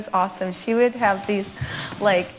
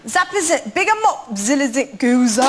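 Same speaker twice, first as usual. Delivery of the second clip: put-on Yorkshire accent; 2 semitones higher than usual. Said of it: "That's a Yorkshire accent".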